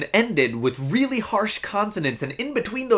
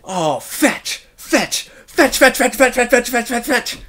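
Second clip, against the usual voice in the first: "fetch" repeated fast, building louder and higher; rapid-fire cursing; 6 semitones higher, 4 dB louder than usual. Shown as a demonstration. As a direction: spit "fetch" over and over like a swear. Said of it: "Just think about it".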